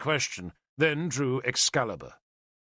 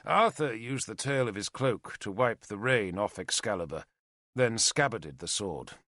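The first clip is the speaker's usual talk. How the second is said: plain reading voice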